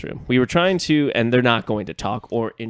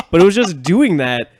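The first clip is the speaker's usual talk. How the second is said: normal talking voice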